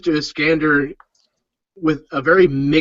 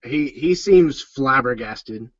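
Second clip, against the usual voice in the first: normal talking voice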